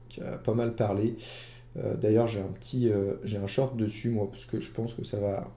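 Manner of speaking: ordinary talk, nothing marked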